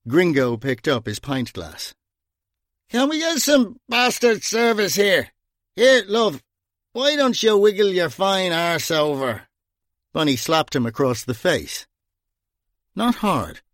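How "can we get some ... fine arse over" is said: high, rude bar shout. A narrator's reading. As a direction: bellow crudely across the bar. Drunk Gringo shouts at barmaid.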